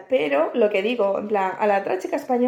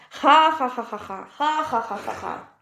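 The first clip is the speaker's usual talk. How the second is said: mocking the mechanic's laughter. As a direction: imitate someone cracking up. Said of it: "Claro, el mecánico".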